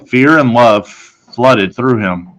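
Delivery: ordinary delivery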